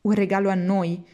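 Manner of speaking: ordinary delivery, nothing marked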